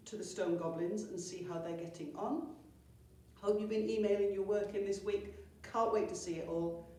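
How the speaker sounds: plain reading voice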